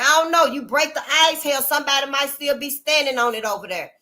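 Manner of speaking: ordinary talk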